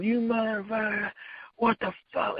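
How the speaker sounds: high, strained mock yell at a thief; retold yelling. Manny yelling at the guy who overcharged. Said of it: "he just started yelling at the other guy".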